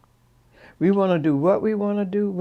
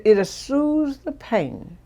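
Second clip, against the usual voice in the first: slow, higher, soothing; a slow, lilting soothing line, higher up; 9 semitones higher, much slower than usual. Retold or imitated. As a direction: stretch it out gently to ease her. Comforting a woman in pain.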